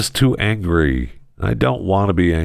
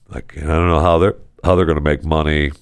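normal talking voice

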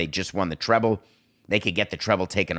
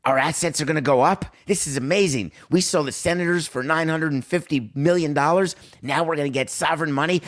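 mocking NHL owner; 7 semitones higher than usual. Host mocking owners cashing in, high giddy voice. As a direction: crow about the money like a giddy owner. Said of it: "Gary Bettman said".

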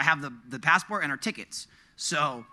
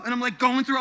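normal talking voice

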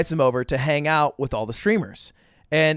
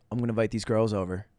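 smoky Hassan impression; 5 semitones lower, 5 dB quieter than usual. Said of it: "Is he saying it kinda smoky like you're saying it?".

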